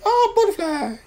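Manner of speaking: put-on high, delighted little kid voice with a laugh; toddler voice. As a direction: squeak it out like an excited toddler. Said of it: "she was like".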